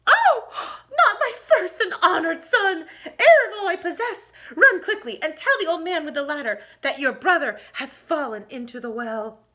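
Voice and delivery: high, loud cry of panic; sudden fright for her son; alarmed mother. Acted. Mother hearing her son fell in the well, crying out high.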